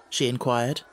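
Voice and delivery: ordinary delivery, nothing marked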